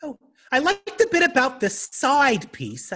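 put-on high, slow old lady voice; older lady. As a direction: answer as a prim old lady, slowly. Voicing older lady reply.

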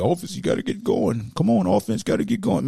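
voicing impatient fans. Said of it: "we say this every week".

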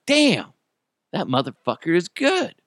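high impressed damn; amazed. Retold or imitated. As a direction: blurt it out in awe. Amazed exclamation.

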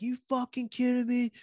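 high, mocking whiny fan rant; hammed-up outraged fan voice; mocking angry fans. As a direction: sneer at the fighter like a sore bettor. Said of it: "And they're like".

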